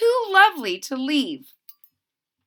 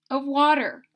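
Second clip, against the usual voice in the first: plain reading voice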